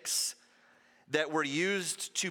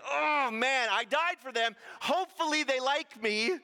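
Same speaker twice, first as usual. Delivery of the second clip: higher, fretful mock voice; mock worried Jesus; 7 semitones higher than usual. Preacher mocks a Jesus anxiously hoping to be liked, fretful. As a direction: play a nervous, hopeful Jesus for laughs.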